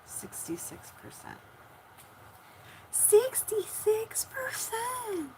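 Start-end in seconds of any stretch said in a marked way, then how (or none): 2.9-5.3 very high, slow, drawn-out movie imitation; Lady and the Tramp imitation. Streamer checking the battery, high drawn-out movie imitation. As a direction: stretch it out in a high put-on cartoon voice. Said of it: "That's from Lady and the Tramp".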